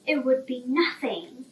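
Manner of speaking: ordinary delivery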